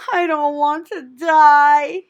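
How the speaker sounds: high, slow, tearful moan. A narrator's reading. Wilbur bursting into tears over his fate, moaning. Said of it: "he moaned".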